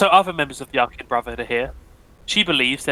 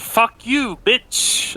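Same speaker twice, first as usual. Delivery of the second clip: shouted insult; 10 semitones higher, 3 dB louder, much slower than usual. Acted. Cultists cursing the party.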